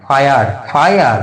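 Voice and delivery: ফায়ার said slowly, its vowel stretched; careful sounding-out. Shown as a demonstration. Teacher demonstrates the I sound in fire, slow careful ফায়ার. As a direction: draw out ফায়ার for learners.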